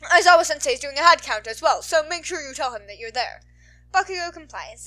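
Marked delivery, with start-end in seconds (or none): 0.0-3.4 loud, earnest formal announcing; urgent class rep. A narrator's reading. Ida tells Bakugo to evacuate, loud and earnest.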